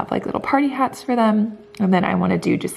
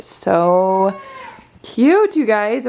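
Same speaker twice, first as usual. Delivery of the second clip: excited gush; 4 dB louder, much slower than usual. Real, not acted. Mom showing viewers matching outfits, excited squeal. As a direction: squeal the word cute with delight. Gushing over matching family clothes.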